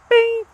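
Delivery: sudden high-pitched playful "Bing!"; ding it like a bell hitting the mark; bing sound effect. Shown as a demonstration. Instructor marking the perfect stop, playful high "Bing!".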